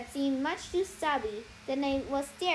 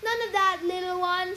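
scolding; 5 semitones higher, 5 dB louder than usual. Acted. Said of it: "she scolded".

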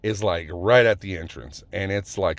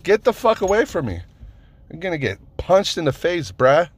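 high, heated re-enacted outburst; freaked out; 9 semitones higher than usual. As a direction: warn him off, replaying your panic and anger. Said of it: "I freaked out".

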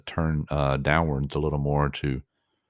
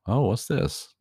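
higher, lightly voiced curious little creature; mock baby wonder at a new sight; Grogu's curious reaction; 7 semitones higher than usual. Grogu spotting R2-D2, lightly voiced curious little creature. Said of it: "he's like".

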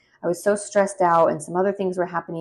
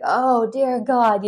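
slow, drawn-out moan of pain; private groan; 6 semitones higher, 5 dB louder, much slower than usual. Retold or imitated. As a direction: drag it out like you're hurting alone. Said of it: "only in private would I just be like".

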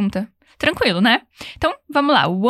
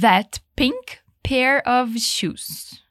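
slow model phrase; much slower than usual. Shown as a demonstration. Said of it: "como você diria".

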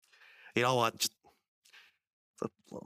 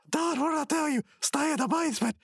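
very high indignant cartoon voice; mock scolding. Birthday kid ordering brother downstairs, high mock scolding. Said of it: "And he goes".

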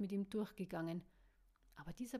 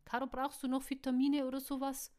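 loud, quick, higher-pitched needling; playful teasing; 5 semitones higher, 7 dB louder, faster than usual. A narrator's reading. Cook needling the woman at breakfast, playful teasing. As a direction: rattle it off as a cheeky jibe. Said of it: "prustete Luke neckisch".

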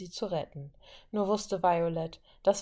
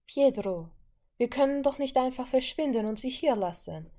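higher, louder plea to her brother; pleading; 6 semitones higher, 3 dB louder than usual. Acted. Wanda pleading with her brother to save the girl, raised pleading voice. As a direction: beg him not to leave her behind. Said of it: "flehte die junge Maximoff".

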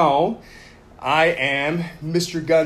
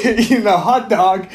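laughing through the words, higher and louder; 5 semitones higher, 5 dB louder than usual. Real, not acted. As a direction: say it while cracking up. Laughs at cohost's joke.

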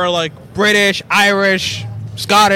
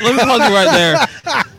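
ordinary delivery

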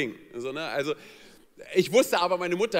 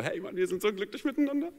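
smug couple; 7 semitones higher than usual. Retold or imitated. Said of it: "manche Pärchen sind ja so".

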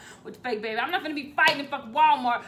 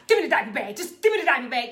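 urgent demand; 9 dB louder, faster than usual. Retold or imitated. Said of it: "Chanel was like".

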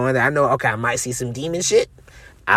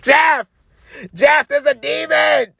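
screaming for roommate; 10 semitones higher, slower than usual. Retold or imitated. Waking roommate Jeff about the demon, panicked shout. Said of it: "I screamed out my roommate's name".

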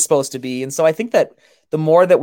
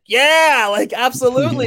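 mock enthusiasm; 8 semitones higher than usual. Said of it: "it was like".

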